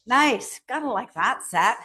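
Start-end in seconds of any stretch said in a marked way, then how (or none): none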